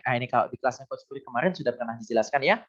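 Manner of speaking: usual speaking voice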